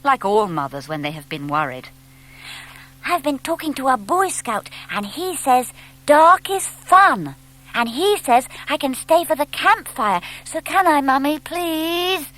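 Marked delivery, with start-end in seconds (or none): 3.0-12.3 little owl begging his mother to stay out; eager little owl. A narrator's reading. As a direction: coax Mummy with wide-eyed hope.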